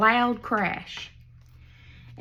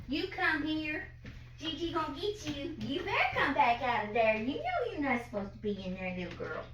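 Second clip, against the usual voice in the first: far off, much softer; off-mic coaxing scolding; 3 semitones higher, 9 dB quieter than usual. Real, not acted. Gigi fetching little girl from the bathroom, distant mild scolding.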